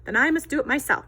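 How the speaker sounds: high, loud determined hen; plucky little hen; resigned hen. Acted. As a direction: say it like a hen who gives up asking. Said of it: "said the little red hen".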